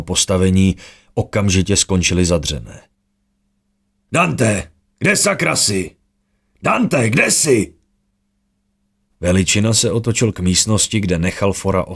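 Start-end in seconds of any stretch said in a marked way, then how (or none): 4.1-6.0 higher, urgent call for Dante; first call, pitched up and quick. A narrator's reading. Calling for a missing comrade. 6.6-7.7 high, slow, far-carrying call; second call, slow and long, higher still; drawn-out call. A narrator's reading. Calling again, drawn out.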